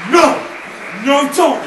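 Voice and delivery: loud, high acted pleading; panicked protest. Drunk friend threatened with a call to the cops, panicked protest. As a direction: cry out to stop him, imitating panic.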